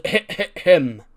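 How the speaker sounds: sudden loud egging on, half-laughing; loud urging. Retold or imitated. Keith Lee egging him on, loud. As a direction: bark it out as a joke cheer.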